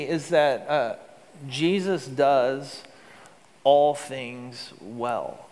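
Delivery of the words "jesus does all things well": very slow, each word weighed; slow emphatic. Shown as a demonstration. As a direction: lay it down slowly like a creed. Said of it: "Would you say that with me?".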